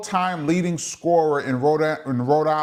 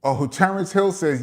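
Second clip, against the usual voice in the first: normal talking voice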